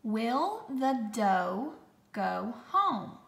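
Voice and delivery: slow, word-by-word dictation; slow, clear dictation voice; dictation pace. Shown as a demonstration. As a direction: read it out slowly for spelling. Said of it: "Write the sentence".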